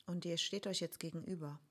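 ruhige, leise Meditationsstimme mit langen Pausen; guided meditation. Acted. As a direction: sanft durch die Meditation führen. Leitet eine Vergebungsmeditation an. (geführte Meditation, leise und ruhig)

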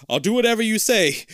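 high, loud eager blurt; eager promise. Acted. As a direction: promise it in a rush. Eager kid.

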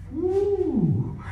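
long, high-pitched "woo". Real, not acted. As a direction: let out a sustained high whoop. Preacher's exclamation while describing hell.

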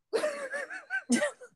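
high-pitched giggle burst. Real, not acted. Laughing at friends who shave arms, squeaky giggle. Laugh after puzzle remark.

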